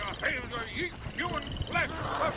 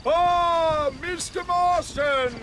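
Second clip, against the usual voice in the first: loud, drawn-out welcome; booming showman welcome to an old friend; showman greeting; 6 semitones higher, 8 dB louder, slower than usual. Acted. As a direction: hail him like a ringmaster, slow and loud.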